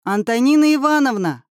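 calling out loud across the rocks; a boy yells for his lost teacher, loud; yelling a name. A narrator's reading. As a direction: shout her name to find her. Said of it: "заорал Семён".

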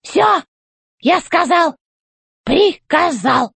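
high, slow, commanding robot boss; robot chef voice. A narrator's reading. Robot chef demanding answers from the old robot, high and commanding. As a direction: bark orders from up high like a tin general.

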